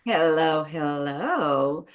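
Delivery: ordinary talk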